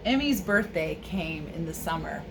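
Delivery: plain reading voice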